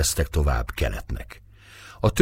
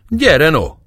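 loud, high-pitched yell; forceful high call, falling at the end; shouted challenge; 11 semitones higher, 8 dB louder than usual. A narrator's reading. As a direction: shout it as a dare. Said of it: "kiáltott rá újból Mekcsei".

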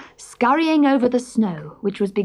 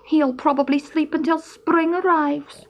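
high, sighing disappointment; resigned sigh; 8 semitones higher than usual. A narrator's reading. Stoat hears Hedgehog snoring, sighing in resignation. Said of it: "he sighed".

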